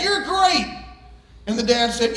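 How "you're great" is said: high echo of the call; mimicked far-off call, pitched up; voice calling back. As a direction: imitate an echo returning the praise. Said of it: "the voice came back".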